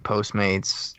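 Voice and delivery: ordinary talk, nothing marked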